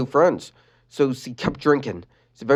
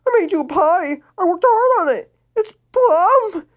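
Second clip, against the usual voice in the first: put-on high crying girl voice, rising; weeping, pitch climbing; 5 dB louder than usual. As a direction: sob through every word. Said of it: "Lenny sobbed".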